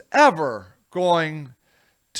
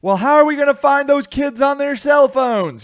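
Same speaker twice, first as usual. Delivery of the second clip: higher, fussy pollster voice, lightly put on; mock worried pollster; 8 semitones higher, 5 dB louder than usual. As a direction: fret like a pollster hunting for young voters.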